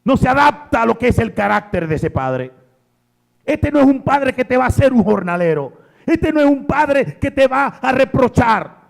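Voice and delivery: high, loud, fast preacher's cry; fervent, fast-building preacher; full-force preaching. Acted. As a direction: drive it home to the congregation. Preaching the father's character.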